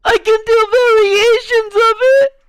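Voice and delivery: high, tearful put-on voice; whiny, pretend-weepy variation; mock-crying character voice. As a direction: keep the high voice on the edge of fake tears. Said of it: "act like I'm gonna cry".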